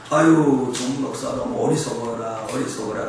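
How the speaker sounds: low, subdued sigh at himself; self-reproach. Retold or imitated. Preacher looks at his scarred hand, retelling his rueful self-reproach. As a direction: softly lament your own foolishness, mock-rueful.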